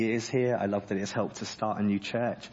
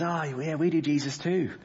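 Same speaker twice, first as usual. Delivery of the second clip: mock American accent; 6 semitones higher than usual. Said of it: "I can't do an American accent".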